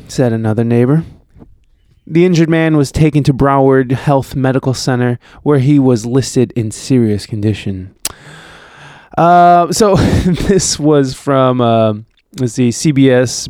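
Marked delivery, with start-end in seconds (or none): none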